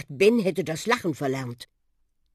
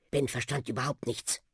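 ordinary delivery, nothing marked

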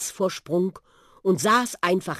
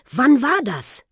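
eager question; 9 semitones higher, 7 dB louder than usual. A narrator's reading. Boy asks the bus eagerly.

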